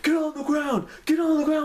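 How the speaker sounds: high, loud barked order; commanding shout. Retold or imitated. Cop yelling at teens to get down, loud commanding shout. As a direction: yell it like a cop with a gun drawn.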